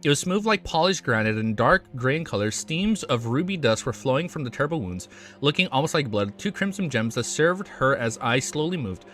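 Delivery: steady storytelling read; story narration. A narrator's reading. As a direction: read the chapter to viewers. Reads novel chapter aloud.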